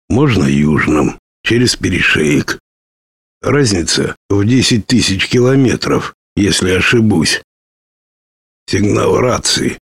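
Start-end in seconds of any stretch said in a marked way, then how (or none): none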